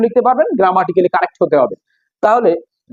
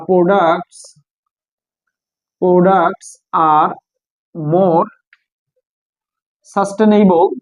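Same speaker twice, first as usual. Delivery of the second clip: slow, word-by-word dictation with long pauses; dictating while writing; much slower than usual. Real, not acted. Teacher writing out an example sentence for the class, slow dictation. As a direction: say each piece slowly, as if writing it down.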